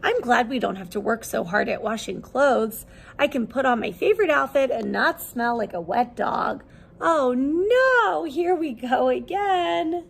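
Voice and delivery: lively, expressive picture-book read-aloud; reading to children. Acted. Teacher reading a laundry picture book to her class, lively storytelling. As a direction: bring the story alive for little listeners.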